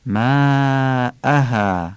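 ماءها very slow, the vowel held long; madd with thin alifs. Shown as a demonstration. Teacher recites ماءها with a long madd. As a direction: draw out ماءها.

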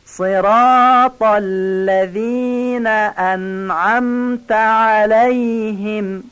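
slow, high chanted recitation; Quranic recitation. Shown as a demonstration. (تلاوت آهسته و آهنگین)

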